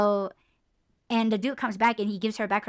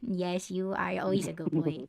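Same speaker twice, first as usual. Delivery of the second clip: pet talk; 2 semitones lower, 7 dB quieter than usual. Real, not acted. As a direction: coo praise at your pet. Praising the dog.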